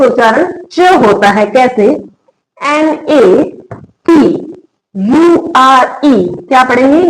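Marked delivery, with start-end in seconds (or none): 2.6-6.3 slow letter-by-letter spelling; name each letter separately, drawn out; spelled out slowly. Shown as a demonstration. Teacher spelling a word for learners, slowly letter by letter.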